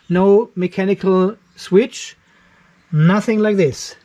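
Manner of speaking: usual speaking voice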